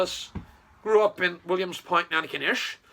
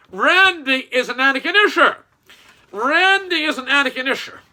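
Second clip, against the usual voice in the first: loud, higher mocking read of the brochure; sarcastic quote; 6 semitones higher, 6 dB louder than usual. Mocking Randy's campaign brochure in a loud, higher sarcastic voice. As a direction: sneer at the slogan. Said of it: "the brochure said".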